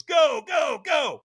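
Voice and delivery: high, loud, rapid-fire send-off; trainer's urging shout. Retold or imitated. Trainer launching firewalker onto the coals, shouting. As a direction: yell them off the line. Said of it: "And the trainer goes".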